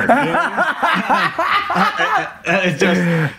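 bursts into high laughter; laughing at his own story. Real, not acted. Laughing after retold story.

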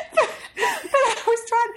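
laughing. Real, not acted. Laughing at her own answer.

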